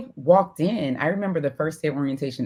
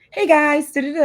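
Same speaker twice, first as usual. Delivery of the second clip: much higher and louder peppy greeting; voice up an octave; 12 semitones higher, 5 dB louder than usual. Shown as a demonstration. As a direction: jump up an octave for the school voice. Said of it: "my voice goes up an octave".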